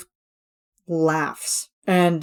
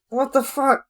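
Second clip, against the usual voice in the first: much higher, stunned what the fuck; mock shocked reaction; 8 semitones higher than usual. Jeff reacting to the chef's stare, mock shock. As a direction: act thrown by the crazy chef. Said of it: "and Jeff is like".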